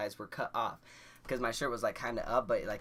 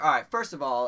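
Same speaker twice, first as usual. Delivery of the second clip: louder firm call to order; officer quieting laughing kids, louder; officer taking charge; 3 semitones higher, 10 dB louder than usual. Retold or imitated. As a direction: bark it to settle the room. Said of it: "He's like".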